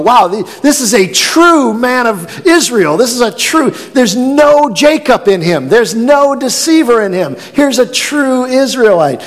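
delighted admiration; excited praise. Acted. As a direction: lift it up with wonder. Preacher voicing Jesus' praise.

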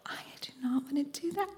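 whispered, fast and reluctant; whispered refusal. Retold or imitated. Refusing her coach's dare, fast reluctant whisper. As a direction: hiss a refusal under your breath.

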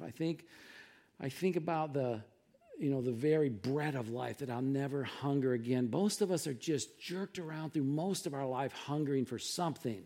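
ordinary talk, nothing marked

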